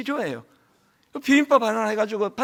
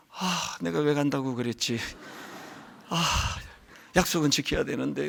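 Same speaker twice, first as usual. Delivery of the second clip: low, much softer, drained grumble; tired mutter to himself; 7 semitones lower, 10 dB quieter than usual. Retold or imitated. Pastor grumbling to himself before the visits, worn out and much softer. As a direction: sigh it out as if your energy is gone. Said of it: "막 체력이 확 떨어지고".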